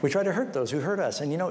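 normal talking voice

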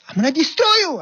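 high, loud furious threat; screaming captain. Retold or imitated. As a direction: spit the threat out. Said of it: "he was screaming and screaming".

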